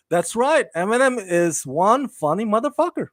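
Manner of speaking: ordinary delivery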